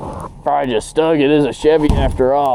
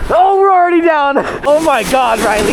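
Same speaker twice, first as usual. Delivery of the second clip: excited; 10 semitones higher than usual. Real, not acted. Excited friends sledding behind truck.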